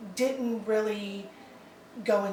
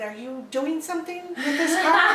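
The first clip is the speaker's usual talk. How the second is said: retold surprise, higher and louder; surprised questioning; 7 semitones higher, 7 dB louder than usual. Wife retells husband questioning her about the magnet card, mock surprised. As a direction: playfully mimic a taken-aback husband.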